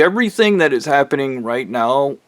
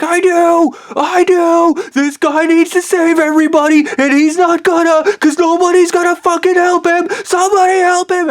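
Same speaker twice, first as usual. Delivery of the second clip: very high, louder frantic scream; retold screaming; 4 dB louder than usual. Speaker insisting to the wives, desperate screaming. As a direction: scream the whole plea at the top of the range. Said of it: "I remember screaming".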